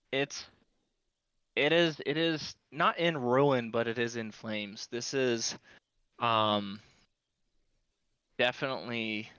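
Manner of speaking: normal talking voice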